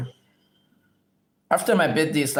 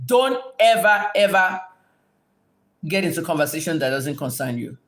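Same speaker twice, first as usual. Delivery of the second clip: stern warning. Real, not acted. Streamer warns commenter to stay out of it, stern. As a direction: hammer out each word as a warning.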